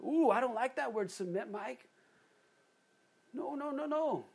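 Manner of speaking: high-pitched mock reluctance; mock objection. Preacher voicing a churchgoer who dislikes "submit", mock protest. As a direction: imitate someone balking, climbing to high no-no-nos. Voices a listener balking at submit.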